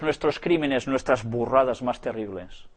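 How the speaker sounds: ordinary talk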